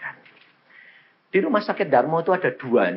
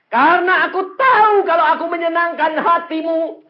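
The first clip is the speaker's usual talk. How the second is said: loud, high-pitched preacher's cry; full-force preaching; 10 dB louder than usual. Acted. Preacher declaring a prayer to God, full-force preaching. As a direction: proclaim it at full volume.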